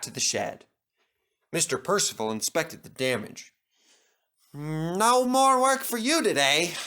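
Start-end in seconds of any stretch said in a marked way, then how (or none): 4.5-6.8 grim. Acted. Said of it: "he said grimly".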